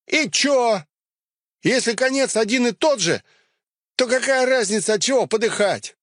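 high, reckless fanatic voice; defiant cultist. Acted. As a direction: shrug off death, taunting.

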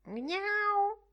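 high, whining cat mew; whining meow. Acted. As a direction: plead like a hungry cat. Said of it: "piagnucolò la gatta". (la gatta piagnucola verso Bloom per il latte)

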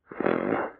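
put-on blackout drunk. A narrator's reading. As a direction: slur it, tongue barely moving. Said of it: "Пробормотал он невнятно, еле ворочая языком".

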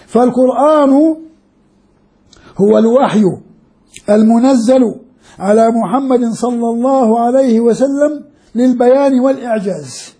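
teacher reading Zarkashi's definition to the class; measured quote reading. Shown as a demonstration. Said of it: "ماذا قال؟".

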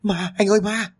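high-pitched cry of terror; frightened scream. A narrator's reading. Wife wakes husband over a ghost, screaming in fright. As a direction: cry out for help in panic, pitched right up. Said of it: "cô liền hét lên".

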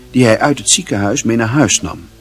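normal talking voice

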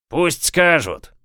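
high, slow, drawn-out call; shout from the back. A narrator's reading. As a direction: call it out over a crowd. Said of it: "послышался крик из задних рядов".

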